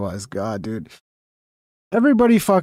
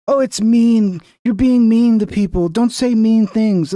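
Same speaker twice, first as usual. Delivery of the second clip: higher-pitched mocking protest, slowing at the end; mock complaining; 5 semitones higher, 3 dB louder, slower than usual. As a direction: scold like an offended critic, sarcastically. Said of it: "It's like, that's what".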